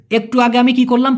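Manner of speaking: ordinary delivery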